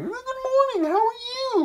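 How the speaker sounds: absurdly high, mock-chipper squeal; phony good morning. Mocking annoying morning people, phony and piercingly high. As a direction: imitate a fake, over-perky greeter.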